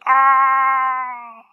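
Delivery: long, high held "aah" of mock pain; drawn-out cartoonish wail on one note; mock agonized scream. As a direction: imitate a man yelling as he burns. Acting king burned on hot throne.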